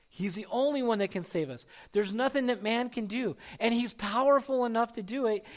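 ordinary talk

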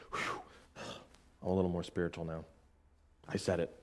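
mock the self-satisfied believer, quietly; smug mock aside. Preacher mocking people who say it flippantly, soft and smug.

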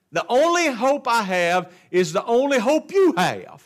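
full-throated sermon delivery; preaching. Real, not acted. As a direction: proclaim it to the whole church.

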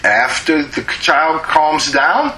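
ordinary talk

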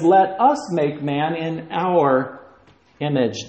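"us" drawn out slowly and leaned on; slow down and press "us"; stress on us. Shown as a demonstration. Repeats verse slowly, stressing us.

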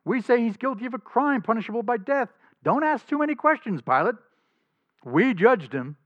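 high, loud, put-on voice of the scheming priests; high mock voice of the leaders leaning on Pilate; mocking the accusers. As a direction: sneer it out like smug accusers hiding something. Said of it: "saying, in effect".

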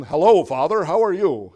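greeting dad nicely for once; polite child. Retold or imitated. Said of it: "Not even".

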